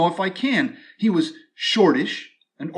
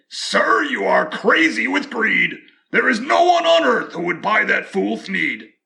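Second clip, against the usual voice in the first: high, loud scolding creature voice; bossy scolding; 5 semitones higher, 3 dB louder than usual. Acted. Lorax scolding the greedy Once-ler, high loud voice. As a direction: scold him sharply, up high. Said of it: "sharpish and bossy".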